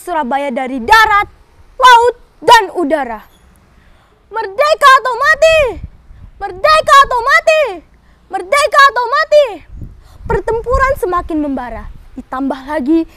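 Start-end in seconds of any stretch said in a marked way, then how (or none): 4.3-9.5 loud high slogan chant; roaring rallying speech. Acted. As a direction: roar it for the crowd.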